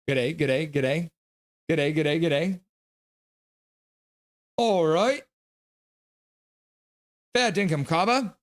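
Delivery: a playful Australian "G'day" greeting; mock Australian accent. Converted to Aussie by chat, a mock Australian greeting. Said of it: "you converted me to Aussie".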